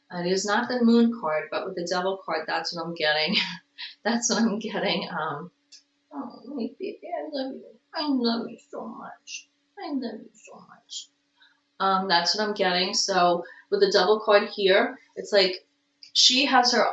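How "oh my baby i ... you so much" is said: tender cooing. Real, not acted. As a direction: murmur lovingly to your pet. Murmuring love to the cat.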